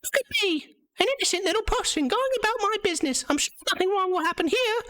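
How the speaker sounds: a tiny naive puppet squeaking happily; squeaky puppet. Acted. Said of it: "you hear a voice".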